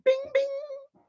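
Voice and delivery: very high, soft ringing ding; chime imitation. Shown as a demonstration. Showing the gentlemanly thing, high soft chime. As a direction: ring it out like a little bell.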